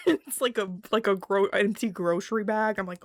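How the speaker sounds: ordinary talk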